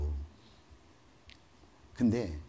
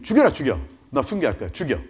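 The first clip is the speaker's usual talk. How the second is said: louder defiant call to be killed; martyr's dare; 8 dB louder than usual. Pretend. Pastor offering himself as martyr, loud dare. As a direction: insist on martyrdom. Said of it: "제가 진짜 그렇게 한다니까요".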